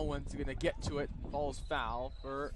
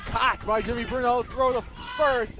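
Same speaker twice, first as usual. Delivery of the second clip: louder, higher play-by-play burst; excited call; 6 semitones higher, 9 dB louder than usual. Real, not acted. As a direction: ramp up as the ball is caught. Play-by-play of a catch and throw.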